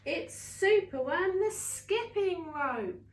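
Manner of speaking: loud, rising triumphant line; triumphant reveal. Acted. Storyteller announcing Superworm's trick to the bees, triumphant. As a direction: announce the hero proudly.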